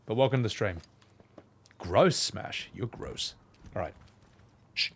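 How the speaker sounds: ordinary talk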